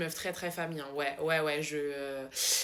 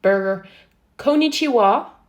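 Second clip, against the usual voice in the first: loud, exaggerated American accent; drawn-out American twang at full volume; mock American accent; 2 semitones higher, 11 dB louder, slower than usual. Trying how Americans say it, loud American accent. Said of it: "un accent américain d'enculé".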